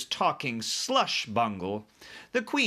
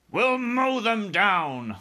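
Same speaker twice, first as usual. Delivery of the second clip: high, drawn-out military cry; loud cry; 10 semitones higher, 6 dB louder, much slower than usual. A narrator's reading. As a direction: cry out like a fighting army chief. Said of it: "cried the head of the army".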